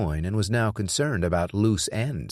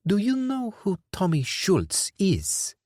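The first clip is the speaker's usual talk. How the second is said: much higher, slow, tense; menacing question; 12 semitones higher, slower than usual. Acted. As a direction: hold back before the outburst. Dufour asking.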